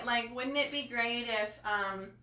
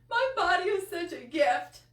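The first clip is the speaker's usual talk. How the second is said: higher, louder, crying through it; tearful affirmation; 6 semitones higher, 4 dB louder than usual. Real, not acted. Host repeating the affirmation to viewers, crying. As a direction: choke it out through tears. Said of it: "I'm just so emotional".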